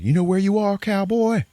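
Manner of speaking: high, loud taunting voice; hammed-up sneering local; mock tough townsman.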